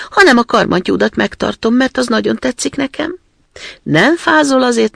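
put-on brash, loud robber girl; a wild girl being generous her own way. Robber girl dresses Gerda. (harsány rablólány)

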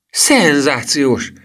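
high, drawn-out excited exclamation; enthusiastic cry. A narrator's reading. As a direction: cry it out slowly with delight, high and loud. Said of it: "kiáltotta Emil lelkesen". (lelkes felkiáltás)

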